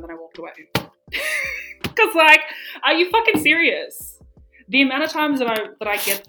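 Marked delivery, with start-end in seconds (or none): none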